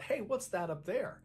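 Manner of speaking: understated narrated shout; mild mock yell. A narrator's reading. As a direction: call out a question as a searcher, lightly acted. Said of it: "one of the searchers yells out".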